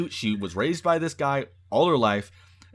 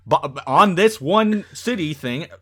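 builds from plain to much louder and higher; mock scheming plan; 4 semitones higher, 10 dB louder than usual. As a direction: rattle off a crazy plan, rising to a shout. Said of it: "And you thought".

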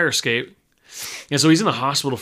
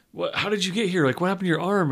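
higher-pitched mock astonishment at normal volume; surprised questioning; 6 semitones higher than usual. Woman asks ghost how he got hurt, surprised questioning. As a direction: voice her startled questions, pitched up.

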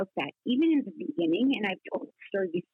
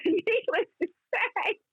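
words spilling out through laughter, slowed; laughing; 11 semitones higher, much slower than usual. Real, not acted. Laughing while recalling his audition blunder. Laughing after golf punchline.

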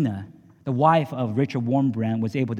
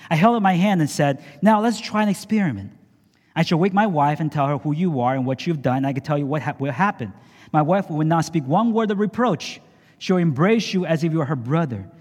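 raised, urgent storyteller voice, pitched high; dramatic story reading; 4 semitones higher than usual. A narrator's reading. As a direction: read it like a gripping testimony. Reads book story aloud dramatically.

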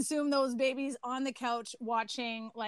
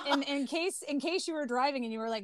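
ordinary delivery

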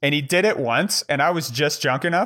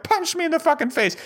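pitched way up, mock-challenging; escalating dare. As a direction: push the dare higher, taunting him. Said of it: "I'm like".

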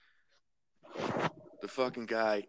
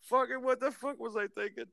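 high-pitched amused self-reproach; retold laughing agreement; 13 semitones higher than usual. Coworker laughing at his own mistake, high-pitched amusement. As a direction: imitate him cracking up, pitched high. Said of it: "Doug starts laughing his fucking head off".

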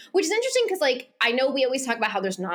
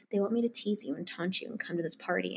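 soft; 4 semitones lower, 9 dB quieter than usual. Retold or imitated. Sitting in his lap, intimate.

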